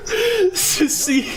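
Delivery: words squeezed out through high helpless laughter; can barely get it out for laughing; laughing hard. Real, not acted. Teller recalls walking in on the prank, cry-laughing. Laughing while retelling absurd scene.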